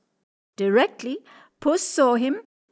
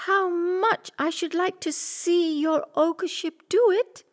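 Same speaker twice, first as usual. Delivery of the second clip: much higher coaxing cat; 9 semitones higher than usual. Acted. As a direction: flatter him sweetly, pitched up. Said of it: "said Puss".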